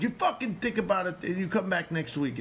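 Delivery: high-pitched mock scolding; yelling. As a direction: send the student off with an irate order. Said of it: "'cause he yelled at me".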